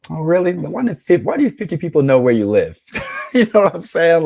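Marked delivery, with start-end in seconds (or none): 0.1-2.8 high, loud sarcastic comeback; sarcastic retort. Real, not acted. Streamer mocks girls who claim to be homebodies, loud sarcasm. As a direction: call out the lie with mock disbelief.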